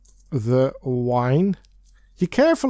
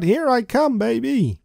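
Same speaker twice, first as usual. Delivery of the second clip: higher, eager call; excited shout; 8 semitones higher than usual. A narrator's reading. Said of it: "Suddenly you shout".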